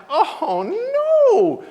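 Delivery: very high, loud drawn-out wail; mock cry of dawning horror; horrified realization. Man realizes whom he lectured, mock-horrified wail. Realizes his blunder, retold.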